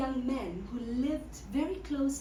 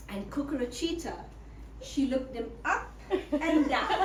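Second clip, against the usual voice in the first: ordinary delivery, nothing marked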